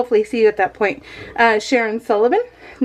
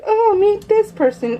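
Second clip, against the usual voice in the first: high bright sing-song, playful; sing-song gushing; 11 semitones higher than usual. Retold or imitated. Introducing a new puppy to her husband, high sing-song. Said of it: "I'm like".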